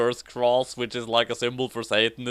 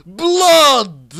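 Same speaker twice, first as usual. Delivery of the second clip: mock shout; 12 semitones higher, 11 dB louder than usual. Character exclaims.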